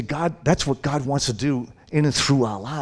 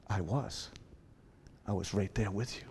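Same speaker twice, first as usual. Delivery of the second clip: gentle reassurance; 4 semitones lower, 12 dB quieter than usual. Retold or imitated. God answers gently.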